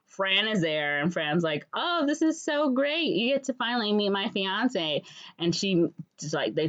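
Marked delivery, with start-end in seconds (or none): 1.7-5.0 high-pitched delighted friend; mock gushing. Fran presents her fiance, mock gushing. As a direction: imitate a bride gushing.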